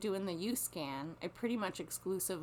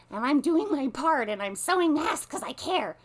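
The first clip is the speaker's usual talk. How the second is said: higher, louder sanctimonious whine; mock self-righteous busybody; 8 semitones higher, 10 dB louder than usual. Mocking the mask-sewing busybodies, sanctimonious. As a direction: parody a smug do-gooder.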